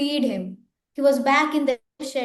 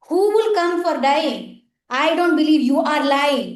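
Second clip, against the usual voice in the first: loud, higher scornful tiger voice; tiger's refusal; 4 semitones higher, 6 dB louder than usual. Acted. Hungry tiger scoffs at the cow's promise, loud and scornful. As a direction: roar it out with disbelief.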